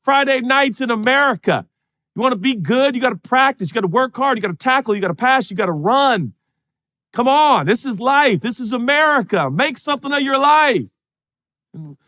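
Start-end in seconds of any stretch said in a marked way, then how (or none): 0.0-10.9 football coach pep talk. Retold or imitated. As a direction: drive the players like a Friday night coach.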